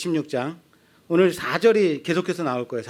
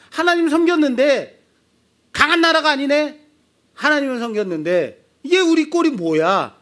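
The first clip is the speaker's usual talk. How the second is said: high, loud griping voice; bitter complaint; 9 semitones higher, 5 dB louder than usual. Acted. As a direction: whine like a disappointed people. Israelites complaining. (높고 크게 불평하는 백성 목소리)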